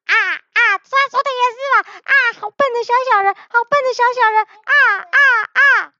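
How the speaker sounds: very high cackling crow voices; flock of crows. A narrator's reading. All the crows mock the silly little people, high cackling caws. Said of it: "所有的乌鸦".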